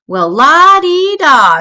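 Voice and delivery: high, drawn-out sneering lilt; mocking. A narrator's reading. Too Tall mocks Brother for sticking up, exaggerated sing-song. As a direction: stretch it out in mock admiration.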